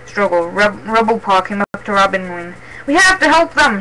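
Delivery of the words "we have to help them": higher, urgent voice; alarmed, raised appeal; gasped plea. A narrator's reading. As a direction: plead anxiously for the others. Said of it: "he gasped".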